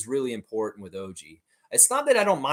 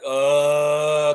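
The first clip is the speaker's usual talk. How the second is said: reluctant groan; 2 semitones higher, 8 dB louder than usual. Retold or imitated. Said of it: "And he's like".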